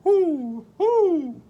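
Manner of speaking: very high, loud hooting; owl hoot. Acted. Voicing the owl for the children, high hooting. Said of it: "asked the owl".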